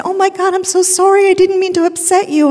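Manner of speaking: high, loud, flustered apology in another woman's voice; apologetic woman. Retold or imitated. Starbucks stranger apologizing to the weeping mother, high and loud.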